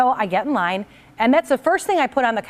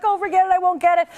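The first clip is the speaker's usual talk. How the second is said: much higher, lightly voiced old lady giving up; timid old lady; 7 semitones higher than usual. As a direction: give up meekly as a frail old lady. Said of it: "She's like".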